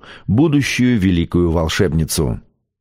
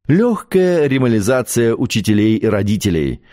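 usual speaking voice

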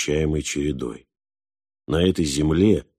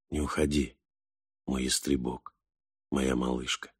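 very soft, low sleepy murmur; half-asleep plea, hushed, low and tender; whispered; 5 semitones lower, 9 dB quieter than usual. A narrator's reading. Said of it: "он прошептал". (тихим сонным шёпотом)

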